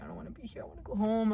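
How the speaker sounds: a panic mantra whispered alone at night; panicked whisper. Retold or imitated. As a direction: whisper it over and over. Panic attack, repeating to herself.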